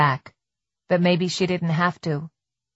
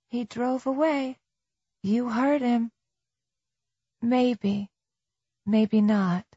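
doubtful inner voice; 6 semitones higher, slower than usual. A narrator's reading. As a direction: reason with yourself in a light raised hush. Tess's inner debate voiced.

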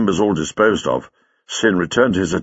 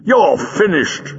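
slow, raised, emphatic; shouted verdict; 7 semitones higher, slower than usual. A narrator's reading. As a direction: call out a final verdict. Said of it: "shouted the Doctor".

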